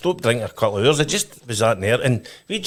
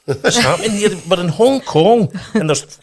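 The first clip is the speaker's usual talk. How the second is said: higher, louder astonished disbelief; amazed; 9 semitones higher, 6 dB louder than usual. Retold or imitated. Dutch players amazed at fans in Hong Kong, astonished. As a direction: act stunned by the crowd.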